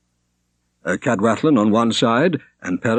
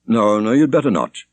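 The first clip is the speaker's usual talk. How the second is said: plain reading voice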